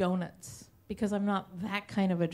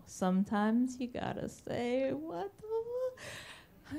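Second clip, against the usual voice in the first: brother's reckless motto; 5 dB quieter, slower than usual. Retold or imitated. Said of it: "he does the whole".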